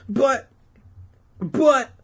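high, loud, cut-short objections; sputtering protest. Acted. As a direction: try helplessly to interrupt. Todd sputtering again, ignored.

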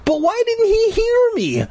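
high, distressed demand; desperate demand. A narrator's reading. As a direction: demand it in panic. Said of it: "I demanded".

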